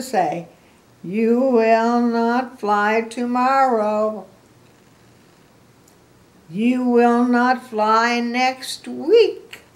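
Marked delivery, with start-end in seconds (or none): 1.0-9.6 loud, very slow, insistent refusal; Gerald. Acted. Gerald keeps refusing Piggy, loud and drawn out. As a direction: stretch every word out, higher and louder.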